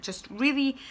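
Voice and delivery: ordinary talk, nothing marked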